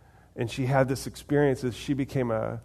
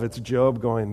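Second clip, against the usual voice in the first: normal talking voice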